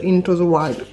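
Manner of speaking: bad English accent on the product name. Real, not acted. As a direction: say "Into the Wild" in a clumsy English accent. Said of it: "mon accent est pourri".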